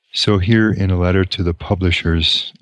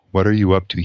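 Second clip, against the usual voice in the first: ordinary delivery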